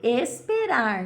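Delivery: drawn out, rising on deve, then a pause; slow, deliberate teacher voice; slow dictation. Shown as a demonstration. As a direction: spell it out slowly for children. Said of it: "teacher dictating answer slowly".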